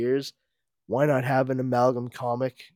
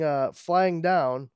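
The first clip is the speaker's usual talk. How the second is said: ordinary delivery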